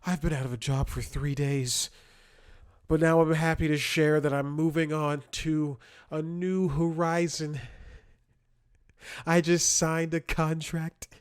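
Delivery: high, loud put-on announcement voice; mocking LinkedIn poster. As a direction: parody a self-important online job update.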